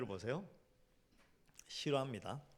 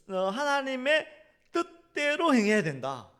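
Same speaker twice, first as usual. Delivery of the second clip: higher, louder fervent preaching; 7 semitones higher, 8 dB louder than usual. Acted. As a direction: build the sermon to its peak. Preaching climax.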